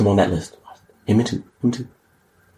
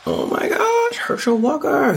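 much higher, hammed-up gasp of recognition; mock surprise; slower than usual. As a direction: act like you've spotted a celebrity.

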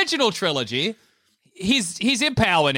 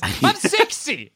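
higher, drawn-out mock exasperation; exasperated old villain; 7 semitones higher, much slower than usual. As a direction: stretch it out in lightly acted indignation. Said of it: "and he's like".

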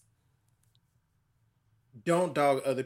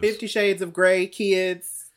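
loud announcement; 6 semitones higher, 7 dB louder, slower than usual. Real, not acted. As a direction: announce it loudly and higher. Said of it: "can we also address the big dragon".

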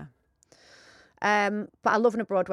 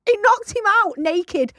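emphatic; 10 semitones higher, 7 dB louder, slower than usual. Real, not acted. Rereads line emphatically.

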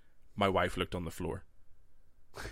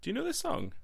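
mock high quiz. Wife quizzing him in car.

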